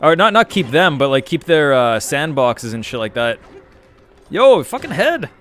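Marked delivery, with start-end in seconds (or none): 4.3-5.3 excited outburst. Real, not acted. Gamer reacts to a headshot.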